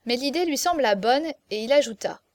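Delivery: ordinary delivery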